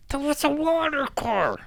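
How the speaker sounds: absurdly high, strained squeal; mock high-pitched outburst. Comedian reacting inside a petrol car, absurdly high mock squeal. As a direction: squeal it in comic exasperation.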